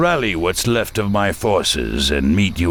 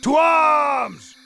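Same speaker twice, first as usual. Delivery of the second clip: alarm shout. Acted. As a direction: bellow it out to rouse the guards. Guard raising the alarm.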